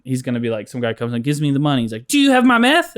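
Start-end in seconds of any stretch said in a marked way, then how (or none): 2.1-2.9 high, loud blurted question; a customer at the store counter asking for meth, blurted; mock customer. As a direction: ask it like a twitchy buyer.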